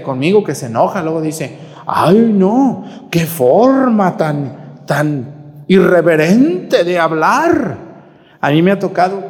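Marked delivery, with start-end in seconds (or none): 1.9-7.8 offended parishioner. Retold or imitated.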